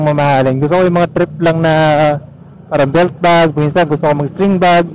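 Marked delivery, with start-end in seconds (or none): none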